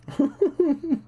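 short high laugh; real laugh after the joke. Real, not acted.

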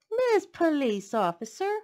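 high, loud surprised call; exclaiming. Acted. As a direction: exclaim it warmly. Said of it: "Omu exclaimed".

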